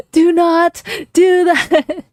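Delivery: high, slow panicked scammer voice; stressed scammer imitation. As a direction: mimic a frantic scammer pleading. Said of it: "the guy's like".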